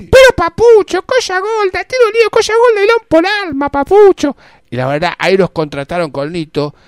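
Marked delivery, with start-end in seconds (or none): 0.0-4.4 very high, loud cartoon voice. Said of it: "[name] haciendo Oaki".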